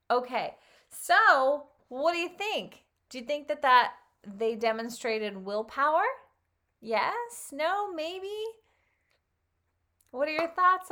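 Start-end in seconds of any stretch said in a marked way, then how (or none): none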